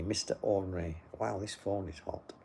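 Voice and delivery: ordinary talk, nothing marked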